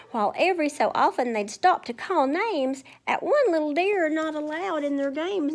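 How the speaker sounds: lilting read-aloud rhyme; sing-song story time voice; storybook reading. Acted. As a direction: read it like a picture book to kids. Reads rhyming story to children.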